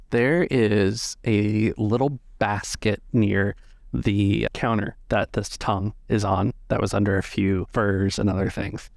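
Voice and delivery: ordinary delivery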